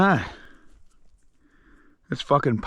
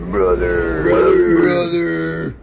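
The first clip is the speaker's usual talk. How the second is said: hammed-up anguished cry of brother, louder; a mock-anguished cry over a fart; mock wail; 6 dB louder, much slower than usual. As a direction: wail it like a ruined man. Wailing after being crop dusted.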